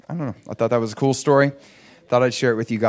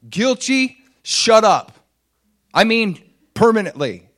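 much higher and louder, commanding; bold rebuke; 9 semitones higher, 7 dB louder than usual. Retold or imitated. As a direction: snap at her to stop for good. He rebukes the coworker's crude talk.

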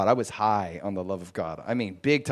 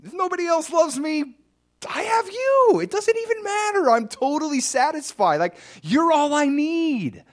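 joyful outburst; 6 dB louder than usual. Retold or imitated. Believer telling God he is all he needs, overjoyed and high. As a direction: burst with relief like all your needs are met. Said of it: "I got so full of joy".

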